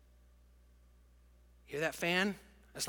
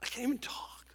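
whispered, too moved to speak; choked-up whisper; 4 semitones higher, 5 dB quieter than usual. Real, not acted. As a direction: barely get the words out, overwhelmed. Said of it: "And I get so overwhelmed".

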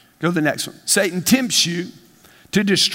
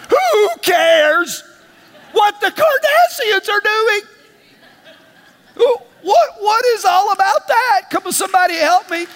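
much higher and louder, slowed, incredulous cry; preaching shout; 11 semitones higher, 3 dB louder than usual. Real, not acted. Preacher rails at the congregation about celebrity gossip, high shout. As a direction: blast it out at full preaching force.

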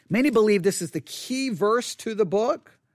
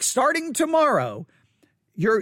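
ordinary delivery

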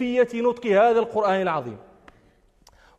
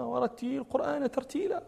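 soft, measured Quran recitation of ورتل القرآن ترتيلا; careful recitation; 2 semitones higher, 8 dB quieter than usual. Shown as a demonstration. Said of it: "ينظر الإنسان إلى قول ربنا".

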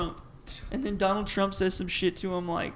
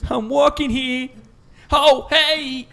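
very loud, high Boston street yell; Boston accent; 6 semitones higher, 10 dB louder, much slower than usual. Acted. Replaying the Boston bit for co-hosts, shouted Boston accent. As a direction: holler slowly in broad Boston. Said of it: "Boston accent is like".

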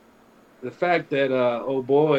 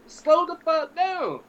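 drawn-out, higher "slow the fuck down"; slow exasperated order; 12 semitones higher, slower than usual. Retold or imitated. To speeding cousin, slow higher exasperated command. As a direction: stretch each word out, high and fed up.

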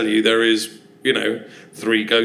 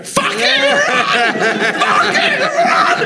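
panicked screaming; slower than usual. Retold or imitated. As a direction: scream it like you're fleeing a ghost. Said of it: "inside I'm going".

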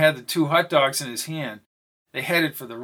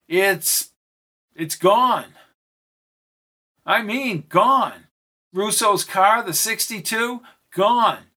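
alarmed; 5 semitones higher, 4 dB louder, slower than usual. Acted.

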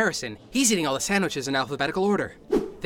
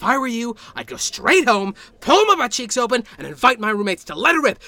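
angry building shout; 7 semitones higher, 7 dB louder than usual. Acted. Angry rant at coworker.

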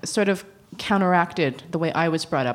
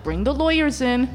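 higher-pitched, daring comeback; retold challenge; 7 semitones higher than usual. Advocate answering the social worker, higher-pitched challenge. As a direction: throw it back as a challenge, pitched up. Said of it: "And I'm like".

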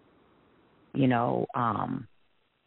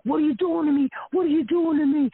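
high, loud mock protest; imitated frantic yelling; 14 semitones higher, 6 dB louder than usual. Ex to woman fleeing toward the ladies, acted high yelling. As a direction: yell it in a much higher voice, imitating him. Acts out ex shouting at her.

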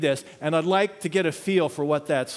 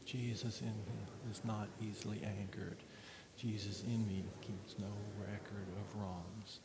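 soft, low recitation sinking to breath; hushed reading fading to a whisper; 7 semitones lower, 13 dB quieter than usual. Acted. Pastor leads a final prayerful reading, fading to a whisper. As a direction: let it fade into a whisper.

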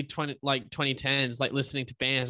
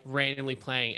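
normal talking voice

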